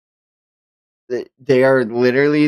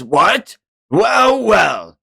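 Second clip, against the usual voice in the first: surprised villain; 9 semitones higher, 5 dB louder, much slower than usual. Acted. Voicing villain impressed by hit.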